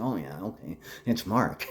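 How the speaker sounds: low, soft relieved realization; quietly placing himself; realizing. Retold or imitated. Waking up and remembering his own name, softly. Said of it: "and then I said".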